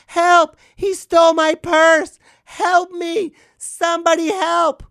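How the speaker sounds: high, loud damsel wail of help; mock cries for help. A robbed woman calling to passersby, hammed-up high shrieking. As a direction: cry out like a robbed lady. Mock purse-snatching victim.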